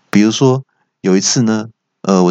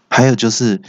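ordinary talk

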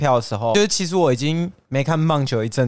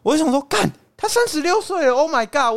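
shocked disbelief; 11 semitones higher than usual. Real, not acted. Shock at the player's age.

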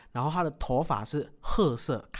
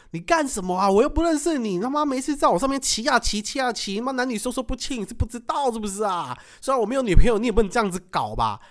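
higher-pitched mock scolding; retold scolding; 8 semitones higher than usual. Scolding the woman who rode him in his dream, mock anger. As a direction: imitate telling off an intruder, half-hearted. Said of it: "我就骂他说".

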